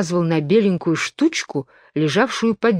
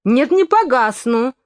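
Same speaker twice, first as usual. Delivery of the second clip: indignant, higher, slowed protest; indignant cry; 6 semitones higher, 3 dB louder than usual. A narrator's reading. Alice protesting to the twins, indignant. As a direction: refuse it hotly. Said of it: "возмущённо крикнула Алиса".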